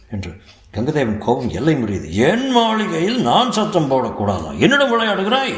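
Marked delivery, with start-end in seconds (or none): 2.3-5.5 angry shouting. A narrator's reading. Lord shouts at the servant, chasing him in rage. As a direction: roar it while chasing him. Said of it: "என்று கூவிக்கொண்டே".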